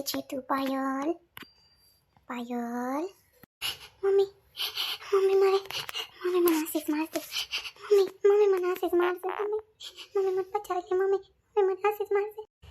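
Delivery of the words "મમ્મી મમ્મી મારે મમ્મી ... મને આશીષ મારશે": put-on frightened child. Frightened girl crying out to her mother, high panicked pleading. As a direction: plead for mummy as if about to be hit. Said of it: "કેવી બિસાડી ગભરાઈ ગઈ".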